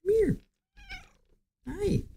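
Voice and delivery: high coaxing pet voice. Real, not acted. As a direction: coax the kitty over. Calling the cat over.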